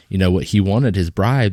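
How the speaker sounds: ordinary talk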